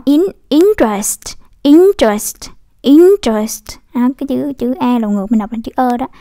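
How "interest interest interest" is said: slow "interest", hit IN hard with a rising tone; stress on first syllable. Shown as a demonstration. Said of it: "in mình nhấn âm dấu sắc".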